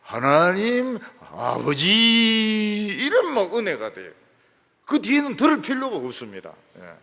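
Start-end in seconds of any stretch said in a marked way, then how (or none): 0.0-2.9 very slow, stretched 하나님 아버지; drawn-out prayer opening. Retold or imitated. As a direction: draw the words out reverently. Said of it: "기도를 참 은혜스럽게 하죠".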